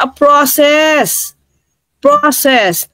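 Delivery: "process" drawn out slowly with a long first vowel; imitated foreign pronunciation. Quoting someone whose "process" was hard to understand, imitated pronunciation. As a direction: stretch "process" as the other person pronounced it. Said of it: "iba yung pag-pronounce natin".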